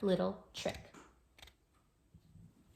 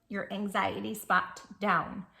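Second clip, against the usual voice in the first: ordinary delivery, nothing marked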